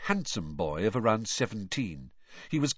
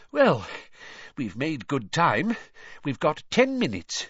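out of breath; 7 semitones higher, 3 dB louder than usual. Acted. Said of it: "panted Mister Weasley".

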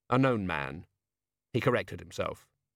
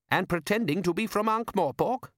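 higher, questioning character voice; a second voice, pitched up; 9 semitones higher than usual. A narrator's reading. Character speaking in story.

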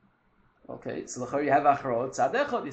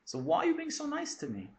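much higher, unsure voice of Ruth asking; voicing Ruth; 13 semitones higher than usual. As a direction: ask it as a timid stranger, pitched up. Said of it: "this is how they look at themselves".

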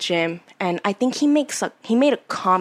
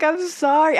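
whiny retold apology; 7 semitones higher than usual. Retold tearful apology to boyfriend.

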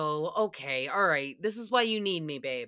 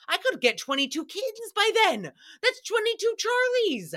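mock excited; 11 semitones higher than usual. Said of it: "He was like".

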